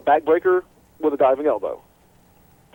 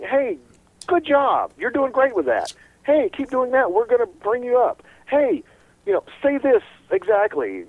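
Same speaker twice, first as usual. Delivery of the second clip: mock pat on the back; 9 semitones higher than usual. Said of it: "patting him on the back and saying".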